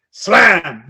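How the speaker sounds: sudden loud burst; slamming door. Retold or imitated.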